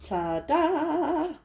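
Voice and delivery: ta-da reveal. Real, not acted. Reveals finished piece.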